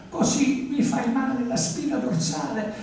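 put-on high-pitched complaint of a squeezed girl; protesting girl. A narrator's reading. Teresa in the artist's grip, high protesting cry. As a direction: protest in a high young-woman voice.

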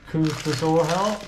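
normal talking voice

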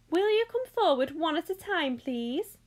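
high, fluty call to the group. A narrator's reading. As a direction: call out a polite instruction, loud and high. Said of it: "he called out".

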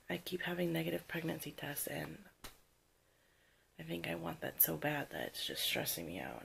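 ordinary talk

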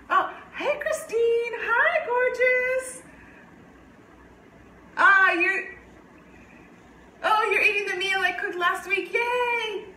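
high, slow, gushing greeting with laughs; excited cooing. Real, not acted. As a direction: coo delightedly at a loved one. Greeting Christine who appears mid-recording.

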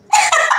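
high shrieking burst of laughter; squealing laugh. Real, not acted.

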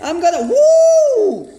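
excited whoop. Real, not acted. Flying fast in game.

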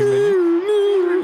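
very high, slow squeaky voice; high, drawn-out cartoon squeal; Minions. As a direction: squeak it out like a little cartoon helper. Imitating Minions' siren after mention.

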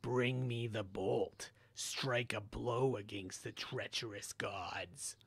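slow, hungry whisper from the abyss; hungrier evil whisper. Acted. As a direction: demand it, starving and slow. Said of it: "The voice became hungrier".

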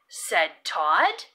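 ordinary delivery, nothing marked

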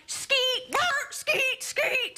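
high rapper ad-libs; skeet ad-lib. Acted. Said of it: "last one".

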